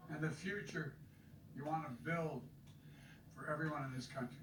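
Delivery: stirring speech building to a shout; rally the crowd, end on a rousing call. Acted. Rally speech continues, building to close.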